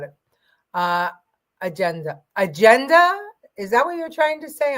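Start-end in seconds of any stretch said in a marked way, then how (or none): 2.4-4.8 rising, puzzled question; baffled by a chat word. Real, not acted. Puzzling over chat comment.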